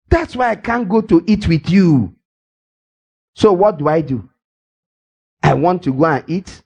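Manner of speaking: normal talking voice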